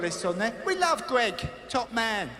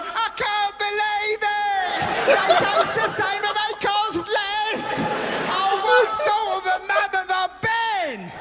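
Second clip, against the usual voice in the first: high, loud, slow hammed-up wail; mock crying wife; 13 semitones higher, 5 dB louder, slower than usual. Comic as the wife wailing over own-brand yogurt. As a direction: overblown weepy breakdown. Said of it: "The wife starts crying".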